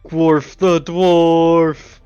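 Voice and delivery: slow, loud cheer; excited shout. Real, not acted. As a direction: belt out the name with glee. Player cheering for the statue.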